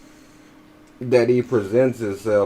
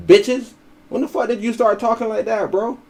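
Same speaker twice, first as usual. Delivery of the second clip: incredulous outburst; 10 semitones higher than usual. Real, not acted.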